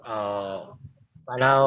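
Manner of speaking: usual speaking voice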